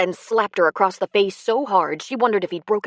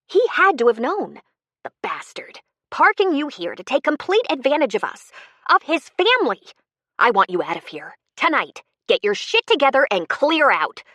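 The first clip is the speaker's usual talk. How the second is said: angry; 7 semitones higher, 4 dB louder than usual. A narrator's reading. Wife throws the con man out.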